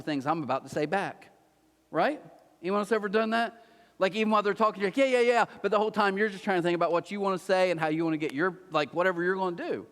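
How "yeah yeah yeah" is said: high, loud brush-off; mock eager agreement, pitched up; impatient fake listening. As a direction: hurry someone along with hollow agreement. Mimicking a distracted listener.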